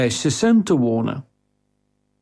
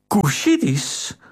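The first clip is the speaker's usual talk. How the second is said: "Kushitisch?" drawn out slowly on a gasp, higher; gasp, then the word in disbelief; gasping shock; 5 semitones higher, slower than usual. Acted. Theo realising the assassin's origin, gasping in shock.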